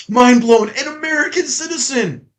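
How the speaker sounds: high-pitched sarcastic astonishment; hammed-up mind-blown reveal; mock amazement. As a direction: act stunned by the obvious. Sarcastic amazement at obvious fact.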